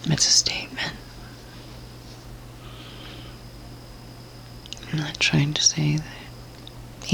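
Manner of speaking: hushed, unvoiced apology under the breath; sheepish aside to any cowboys, whispered. Real, not acted. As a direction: whisper it as a sheepish side note.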